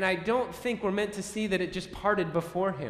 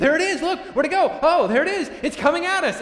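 much higher, louder, frantic cries; panicked disciples; 12 semitones higher, 11 dB louder, faster than usual. Acted. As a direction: shout in fear at the figure on the water. Acted disciples seeing ghost.

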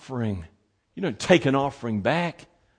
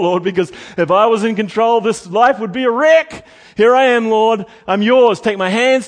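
fervent spoken prayer; 6 semitones higher, 6 dB louder than usual. Pretend. Preacher voices a prayer.

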